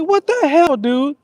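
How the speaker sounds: high, loud outraged yell at a buddy; mock-furious cussing at a friend; retold cussing. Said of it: "Well, I sure was cussing".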